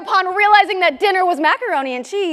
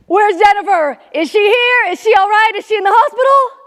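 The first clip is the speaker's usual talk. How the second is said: loud, high, frantic questioning; bursting in; 5 semitones higher, 5 dB louder than usual. Acted. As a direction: fire off panicked questions as a worried father. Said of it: "a voice burst into our cabin".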